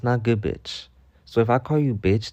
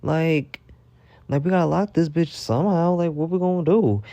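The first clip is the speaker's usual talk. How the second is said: alarmed; 7 semitones higher than usual. Retold or imitated. Panicked over stolen lock.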